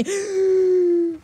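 long high gasp; startled gasp. Real, not acted. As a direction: suck in air in shock. Startled by play.

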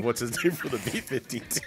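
ordinary delivery

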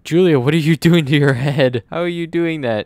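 loud, high, laughing at the screen; laughing disbelief. Real, not acted. Player laughing at Julia's twisting head, loud and high. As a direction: crack up at the weird head.

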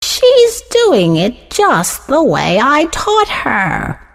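put-on high, drawn-out old-lady pride; proud. A narrator's reading. Missus Gray admiring her pupil's sewing, proudly. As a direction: savour each word with pride, high and slow. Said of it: "said to herself proudly".

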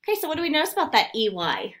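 normal talking voice

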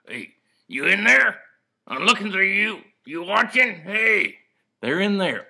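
calling out. Acted. Dummy calls into the DVD.

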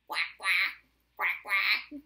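loud, low quacks in pairs; duck quack. Pretend. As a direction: quack along like ducks. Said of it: "Can we be ducks together".

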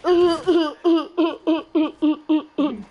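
loud, ugly, mocking laugh; walrus laugh. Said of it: "She's like".

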